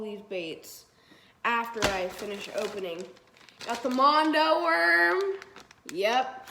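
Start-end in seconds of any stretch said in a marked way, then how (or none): 3.7-5.2 loud, high, straining morning voice; morning voice pushed up high and loud; early-morning voice. Real, not acted. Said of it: "sorry, my voice is early in the morning".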